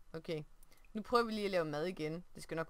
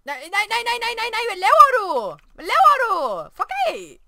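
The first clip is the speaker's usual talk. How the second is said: real panic, shouting high and loud at the screen; panicked yelling; 18 dB louder than usual. Real, not acted. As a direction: yell at the Sim to stop, voice cracking high. Player panics at Sim's cooking.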